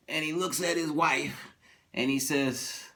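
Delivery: ordinary talk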